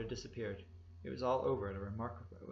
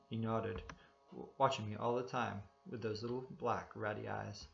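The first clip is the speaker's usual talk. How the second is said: plain reading voice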